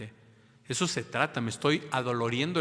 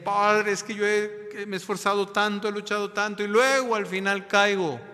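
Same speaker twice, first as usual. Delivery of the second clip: higher, louder pleading voice; a parishioner pleading to the priest, higher and louder; complaining parishioner; 8 semitones higher, 4 dB louder than usual. Retold or imitated. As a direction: plead like a frustrated penitent. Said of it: "Me decía alguien".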